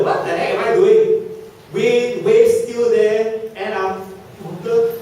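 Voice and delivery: mock alarm at the wind and waves; panicked Peter. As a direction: blurt it like a man losing his nerve. Peter's panicked thoughts voiced.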